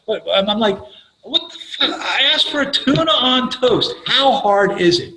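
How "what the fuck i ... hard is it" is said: mock outraged rant. Retelling his outburst at waitress.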